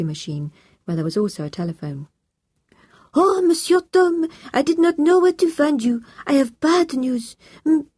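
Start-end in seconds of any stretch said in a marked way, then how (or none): 3.1-7.9 put-on high, loud, agitated French-accented voice; distressed French housekeeper. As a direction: play an upset French housekeeper breaking bad news. Voices housekeeper giving bad news.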